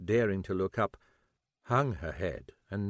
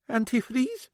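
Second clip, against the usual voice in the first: puzzled. Acted.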